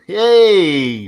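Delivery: a high, loud little cheer of joy; excited cheer. Real, not acted. Host cheering that the stream went live, excited. Celebrating going live on LinkedIn.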